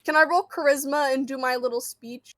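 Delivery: ordinary delivery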